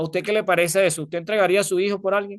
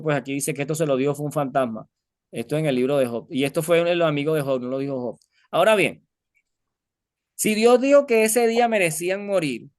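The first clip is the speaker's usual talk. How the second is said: normal talking voice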